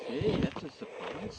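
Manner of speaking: dry sarcastic whisper; deadpan mock surprise. Real, not acted. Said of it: "pardon our sarcasm".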